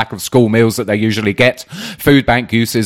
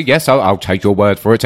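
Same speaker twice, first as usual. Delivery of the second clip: usual speaking voice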